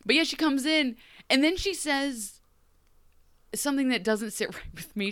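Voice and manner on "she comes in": usual speaking voice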